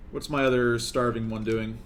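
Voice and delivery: ordinary delivery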